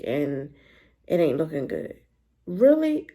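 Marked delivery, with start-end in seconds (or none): 2.5-3.1 disbelief. Real, not acted. Outraged at the show's writers.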